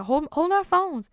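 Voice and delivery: high-pitched imitation of the girl; mock high girl voice; girl's high voice. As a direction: mimic her squeaky plea. Girl voiced in retold story.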